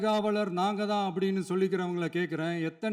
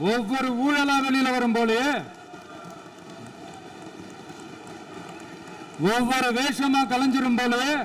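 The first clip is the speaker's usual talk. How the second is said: raised, higher rally voice; projected political jibe, pitched up; loud speech; 3 semitones higher than usual. Real, not acted. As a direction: call it out across a crowd. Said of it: "நான் ஏன் கொஞ்சம் சவுண்டா பேசுறேன்".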